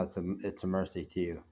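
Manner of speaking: normal talking voice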